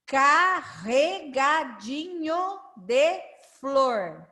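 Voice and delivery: drawn-out syllables, evenly spaced; slow model reading. Shown as a demonstration. Teacher rereading the rhyme with pupils, slow syllable-by-syllable reading. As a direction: read slowly so learners can follow along.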